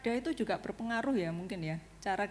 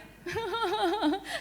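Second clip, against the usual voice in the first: startled laugh; 12 semitones higher, 4 dB louder than usual. Retold or imitated. Mom laughs at her own reaction.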